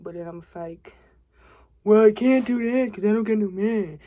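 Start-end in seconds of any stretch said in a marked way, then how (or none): 1.8-4.0 quick, louder, pitched-up sass; sassy retort. Retold or imitated. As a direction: snap back at yourself with mock attitude. Acting out her own retort.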